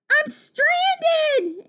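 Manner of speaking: high-pitched, desperate holler; shouting in distress. Acted. Frog stranded in the flood, shouting. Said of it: "he shouted".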